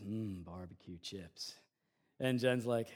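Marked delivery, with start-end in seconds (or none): 0.0-1.5 low, slow, hushed savoring; mock craving. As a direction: imitate a kid drooling over snacks. Boy eyeballs the pantry food.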